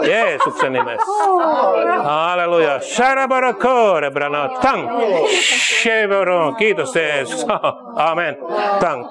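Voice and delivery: forceful praise breaking into tongues; fervent climax of a prayer; ecstatic prayer. Real, not acted.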